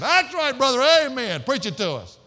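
high, loud congregation holler; mock amen shouting. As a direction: cheer the preacher on loudly, falling off at the end. Acting out an oblivious amen-shouter.

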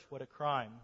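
ordinary talk

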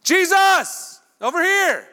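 shouting high and loud; screaming. Retold or imitated. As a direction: call out to get his attention. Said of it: "start screaming".

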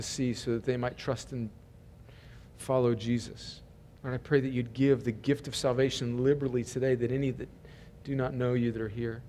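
low, soft, measured prayer; public prayer. Real, not acted. Pastor praying for his church before the sermon, low and hushed. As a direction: pray quietly with pauses between petitions.

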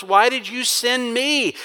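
selfish complaint. Acted. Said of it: "as if he's saying".

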